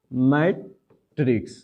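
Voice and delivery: Matrix drawn out very slowly, falling at the end; slow dictation. Shown as a demonstration. Teacher dictating the word to students, slow and drawn out.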